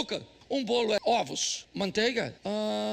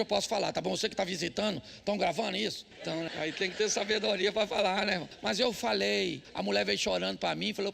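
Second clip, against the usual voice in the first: ordinary talk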